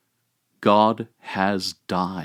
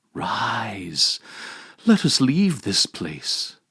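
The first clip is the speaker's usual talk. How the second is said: whisper; 2 semitones higher, slower than usual. Acted. Christ bids sleeping Adam rise, whispered. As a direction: breathe the word like a secret.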